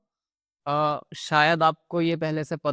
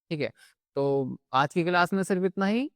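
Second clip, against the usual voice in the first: ordinary talk, nothing marked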